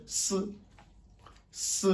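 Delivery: soft hissed "s"; plain s hiss. Shown as a demonstration. Teacher showing Chinese s to learners, quiet. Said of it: "simple comme en français".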